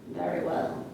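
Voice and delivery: lightly voiced wolf, low and soft; a hint of a low wolf. Wolf agrees to the girl's choice, lightly voiced wolf. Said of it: "And the wolf said".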